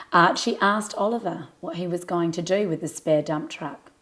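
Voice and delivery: plain reading voice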